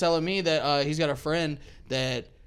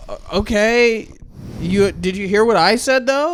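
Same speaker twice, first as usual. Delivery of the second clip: retold pushback, high and louder; indignant disbelief; 7 semitones higher, 6 dB louder than usual. Driver challenging the cop over the ticket, indignant disbelief. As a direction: act out a driver who can't believe it.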